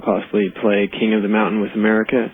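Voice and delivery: normal talking voice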